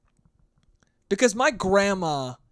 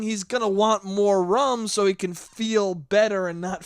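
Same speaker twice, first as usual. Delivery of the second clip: usual speaking voice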